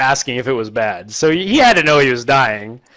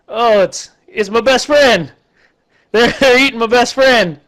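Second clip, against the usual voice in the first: high, strained wail of a voice with a laugh breaking in; hammed-up heartbroken cry, pitched up; mock distraught giant; 9 semitones higher than usual. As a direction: whine it out like a big dumb monster losing his buddy. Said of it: "And he's like".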